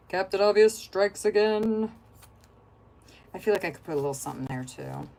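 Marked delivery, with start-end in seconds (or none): 0.1-1.9 mock announcer.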